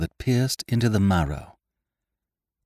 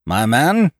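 loud, higher captain's address; stern captain; 5 semitones higher, 6 dB louder than usual. A narrator's reading. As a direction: address an inferior coldly and loudly. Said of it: "said Captain Smollett".

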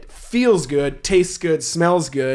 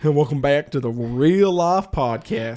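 slow, drawling mock accent; bad put-on accent; slower than usual. Host welcoming listeners to podcast, bad mock accent. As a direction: drag it out in a badly faked accent. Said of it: "He's so bad at imitating accents".

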